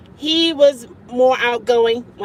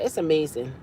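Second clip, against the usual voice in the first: normal talking voice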